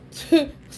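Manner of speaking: a short real burst of laughter. Real, not acted. Vlogger laughing at herself over the necklace, loud laugh. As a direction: laugh loudly at your own story.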